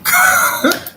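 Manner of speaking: loud high laugh; high burst of laughter. Real, not acted. Laughing at the caller's voice.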